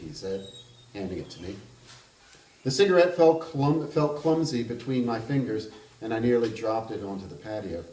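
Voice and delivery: measured reading of a literary novel aloud; even, unhurried narrator voice; story narration. A narrator's reading. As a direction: read it like an author at a book event.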